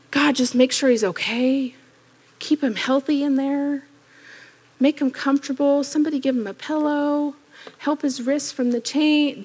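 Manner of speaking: soft, small mock prayer; little tiny prayers. Preacher mimics the church's timid prayers for Peter, softly. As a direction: pray timidly, barely asking. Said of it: "those little tiny prayers".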